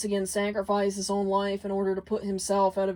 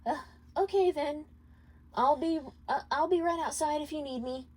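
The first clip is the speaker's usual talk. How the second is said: put-on higher, hesitant young woman; Charlie's voice; 7 semitones higher than usual. Charlie backing out of the room, high and hesitant. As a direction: give in reluctantly, a little unsure.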